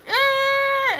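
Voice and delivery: high, loud held cry of yeah; anxious shriek. Retold or imitated. Sister-in-law feeding his nerves, high anxious shriek. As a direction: squeal it with mounting anxiety. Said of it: "And she's like".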